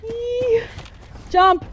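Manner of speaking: high, loud squealing panic; scared horror game streamer. Real, not acted. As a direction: shriek as it closes in. Enemy right behind the player.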